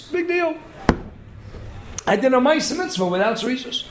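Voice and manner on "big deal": dismissive. Acted. Acted shrug at missing promptness.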